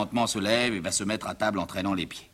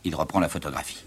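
ordinary talk, nothing marked

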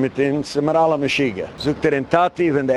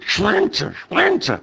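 retold shout of a name; 9 semitones higher than usual. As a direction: yell the name across the ward like a madman. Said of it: "und du eine schreit".

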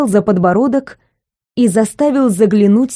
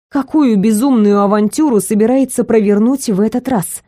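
usual speaking voice

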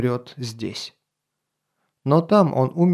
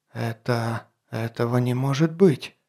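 much softer, shaken near-whisper; frightened whisper; 4 dB quieter than usual. Acted. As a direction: breathe it out in disbelief. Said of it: "испуганно прошептал граф Авенлеба".

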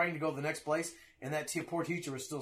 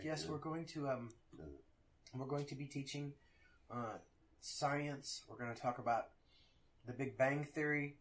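softer, halting delivery with ums; lightly voiced hesitant teacher; 2 semitones lower, 8 dB quieter than usual. Teacher telling parents the science syllabus, hesitant. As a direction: imitate a teacher carefully feeling her way.

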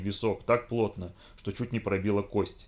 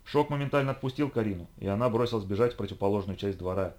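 usual speaking voice

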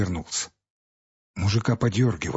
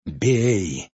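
fierce. Acted. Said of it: "подхватил мужик свирепым голосом".

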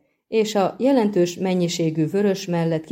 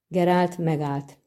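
usual speaking voice

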